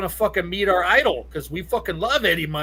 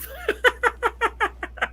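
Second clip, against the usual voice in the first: high breathless laugh. Real, not acted. Laughing at own story.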